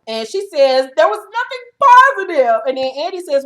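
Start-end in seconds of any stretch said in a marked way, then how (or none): 1.0-2.6 high, loud indignant protest; indignant Kim. Retold or imitated. Kim protesting to Andy, high indignant voice. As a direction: protest it, offended.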